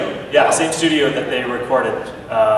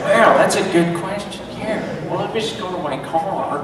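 higher-pitched comic celebrity impression; Owen Wilson; 4 semitones higher than usual. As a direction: lift the voice into a playful film-star mimicry. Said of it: "Is Owen Wilson a, an accent?".

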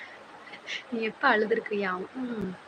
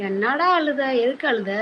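loud questioning; 5 semitones higher, 10 dB louder than usual. Real, not acted. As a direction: ask your son loudly, pitch jumping up. Calling out to crying son.

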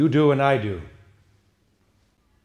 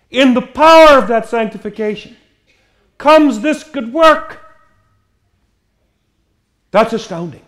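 loud, rising preaching with a raised pitch; forceful preaching; 11 semitones higher, 9 dB louder than usual. Acted. Preacher rousing his congregation, forceful preaching. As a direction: drive it home to the congregation.

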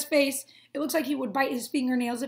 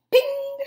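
high cartoon bing cry; bright bing; 13 semitones higher, 7 dB louder than usual. Grass blade growing with a high bing.

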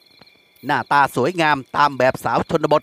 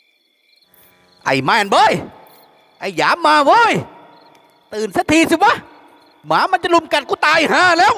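blaring call; 12 semitones higher, slower than usual. A narrator's reading. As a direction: blast it out to wake the whole house. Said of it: "จึงแผดเสียงเรียก".

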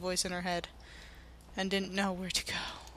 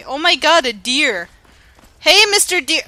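higher, louder, delighted; excited about the deer; 7 semitones higher, 13 dB louder than usual. Real, not acted. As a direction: call to the deer with glee.

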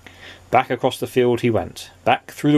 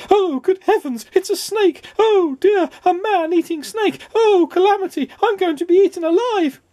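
very high, squealing wail of terror; hysterical panic; 3 dB louder than usual. A narrator's reading. Mister Jelly facing a man-eating snake, hysterical panic. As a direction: wail each line in a squeaky, frightened voice.

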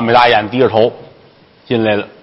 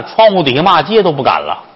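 plain reading voice

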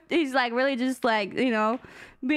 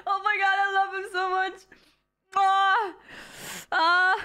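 laughing through tears; 7 semitones higher than usual. Real, not acted. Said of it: "I'm gonna cry".

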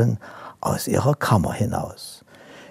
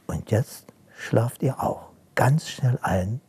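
whispered goodnight; 5 dB quieter than usual. Real, not acted. Bedtime goodnight to the children.

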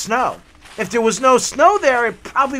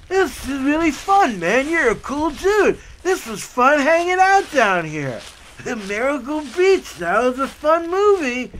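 cheerful dude voice; 6 semitones higher than usual. Retold or imitated. Tall guy says goodbye.

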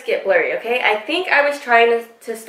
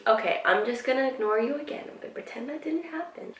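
fast, hushed mutter to herself; muttered aside; 3 semitones higher, 11 dB quieter, faster than usual. Retold or imitated. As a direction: say it under your breath.

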